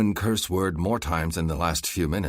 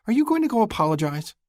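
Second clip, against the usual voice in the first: sister voice; 5 dB louder, faster than usual. A narrator's reading. Sister voiced by male narrator.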